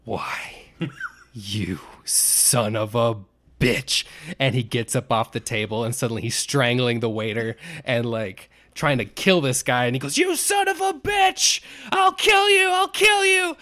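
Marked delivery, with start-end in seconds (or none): 0.0-4.0 slow, sour build-up of anger; slow-burning mock rage before the explosion; Chris Farley seething. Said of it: "his face gets really sour". 10.2-13.6 high-pitched, full-out enraged scream; hammed-up screaming fury, much higher; Chris Farley screaming. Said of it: "Chris Farley is screaming".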